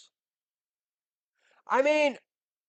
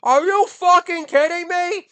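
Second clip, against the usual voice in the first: higher and louder, outraged; exasperated yell; 9 semitones higher, 6 dB louder than usual. Real, not acted. Ranting comic demands the audience see the absurdity, yelling. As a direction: blow up in disbelief. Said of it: "I'm cursing for emphasis".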